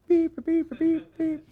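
high-pitched censor bleeps; imitated bleeps. Preacher mimicking a bleeped television show, high beeps. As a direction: squeal out TV censor tones. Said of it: "have to be bleeped out".

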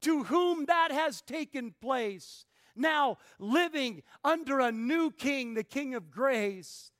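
high, emphatic pulpit delivery; forceful preaching. Real, not acted. Preacher expanding Paul's question about grace, forceful preaching.

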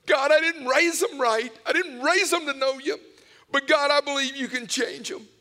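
high, loud, desperate prayer; crying out. Retold or imitated. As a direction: cry out to God for your family, imitating a pleading father. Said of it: "my dad is crying out".

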